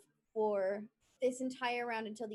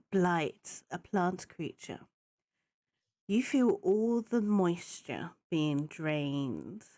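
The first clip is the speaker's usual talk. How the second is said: game master narration; 5 semitones lower, slower than usual. Acted. DM describing the blight's effect.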